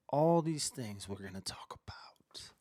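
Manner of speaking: unvoiced secretive whisper. Livestream host teasing the viewers, whispering. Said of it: "You like my whisper?".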